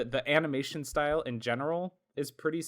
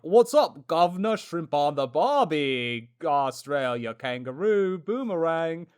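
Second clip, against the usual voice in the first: mock Australian accent; 4 semitones higher, 7 dB louder, slower than usual. Host mocking Wolverine's voice actor, hammed-up Australian accent. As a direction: ham up a bad fake Australian accent. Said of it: "sounds like somebody trying to be like".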